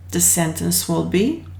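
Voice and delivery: ordinary delivery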